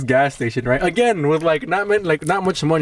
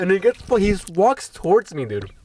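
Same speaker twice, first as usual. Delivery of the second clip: ordinary talk, nothing marked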